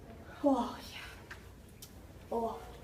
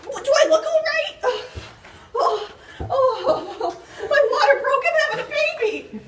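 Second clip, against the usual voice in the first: high, loud panicked yells with a laugh breaking through; panicked screaming; 10 semitones higher, 4 dB louder than usual. Acted. Wife whose water broke screams to Karen in hammed-up panic. Labouring wife snaps at doctor.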